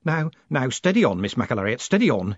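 ordinary delivery

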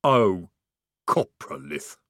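mild troll rumble; slow troll voice. A narrator's reading. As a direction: say it like a dim troll. Troll voicing.

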